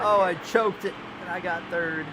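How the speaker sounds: high, loud frustrated groan of a line; exasperated outcry. Real, not acted. Driver after blowing the finish, loud frustrated outcry. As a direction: cry it out, angry at yourself. Just lost second place.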